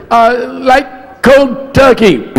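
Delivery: high, slow mouthful mumble. Retold or imitated. Retelling [name]'s pitch, high slow mumbled imitation. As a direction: drag it out like a mouth full of food. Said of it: "He goes".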